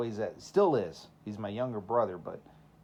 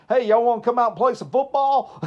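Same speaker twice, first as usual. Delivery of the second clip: put-on loud, high, twangy Southern country accent; 12 semitones higher, 13 dB louder than usual. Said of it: "Sound like Larry the Cable Guy".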